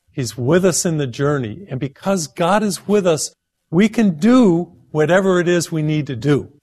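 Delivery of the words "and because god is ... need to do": higher-pitched, heartfelt encouragement; enthusiastic. Real, not acted. Preacher assuring viewers that God is with them, enthusiastic. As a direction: say it with enthusiasm and conviction. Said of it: "I say that with enthusiasm".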